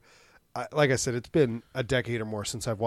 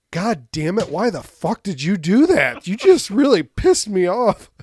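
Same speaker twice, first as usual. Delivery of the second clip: rising from gritted annoyance to a loud, slower angry rant; exasperated outburst; 5 semitones higher, 8 dB louder, slower than usual. Retold or imitated. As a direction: vent at a coworker who keeps messing up, getting louder. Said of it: "you're like".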